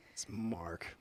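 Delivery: whispered, resigned correction; muttered correction. Retold or imitated. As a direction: whisper the correct name under your breath. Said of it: "Instead of Mark".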